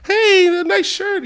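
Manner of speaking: very high, slow imitated compliment; slow, high-pitched put-on voice; admiring passerby. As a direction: draw it out high, like someone calling over. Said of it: "C T V interviewing this kid".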